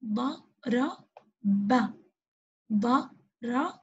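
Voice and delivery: ضرب stretched very slowly; slow blending. Shown as a demonstration. Teacher sounding out ضرب slowly for kids. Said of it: "إذن حرف الباء".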